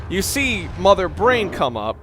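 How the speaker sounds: ordinary talk, nothing marked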